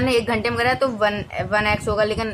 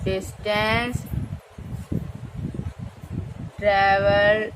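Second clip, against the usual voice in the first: drawn-out, word-by-word dictation pace; slow dictation; 3 semitones lower, 4 dB quieter, much slower than usual. Shown as a demonstration. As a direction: dictate slowly as you write it on the board. Teacher dictates while writing. (धीमे-धीमे लिखवाते हुए बोलना)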